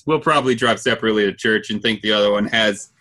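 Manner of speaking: ordinary delivery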